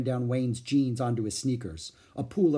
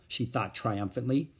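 usual speaking voice

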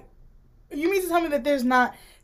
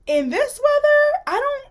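incredulous mock; 13 semitones higher, 4 dB louder than usual. Mocking a father who should doubt baseball in a storm, high and incredulous. Mocking the expected skeptical reaction.

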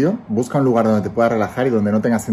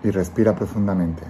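calm guiding voice, let each phrase settle; guided meditation; 3 semitones lower, 7 dB quieter than usual. Acted. Leads a guided visualization.

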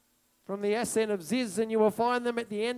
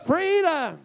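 a high, loud cry of joy; excited whoop; 11 semitones higher, 14 dB louder, slower than usual. Retold or imitated. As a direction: celebrate breaking free. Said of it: "they were like".

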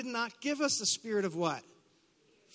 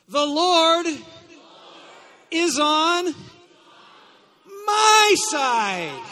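full-force shouted proclamation, high and broken up by pauses; fervent declaration; 7 semitones higher, 12 dB louder, much slower than usual. Shown as a demonstration. Preacher urging congregation to mean it, fervent shout. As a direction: shout it out like you mean every word. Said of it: "like it actually means something to you".

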